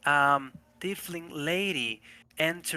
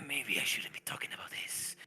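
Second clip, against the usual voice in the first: breathy hushed aside, softer; hushed whisper; 4 semitones lower, 10 dB quieter than usual. Acted. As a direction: drop to a guilty whisper. NPC backs off, hushed.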